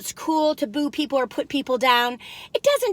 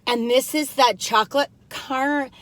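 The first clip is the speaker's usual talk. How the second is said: ordinary talk, nothing marked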